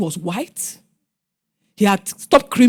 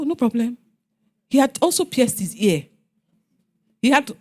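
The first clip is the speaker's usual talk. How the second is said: usual speaking voice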